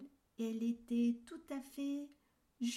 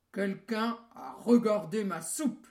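grosse voix du grand ours; 13 dB louder than usual. A narrator's reading. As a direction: roar it like a huge papa bear. The big bear speaks. (grosse voix d'ours qui tonne)

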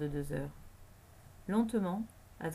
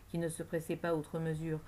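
ordinary delivery, nothing marked

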